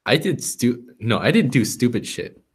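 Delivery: ordinary delivery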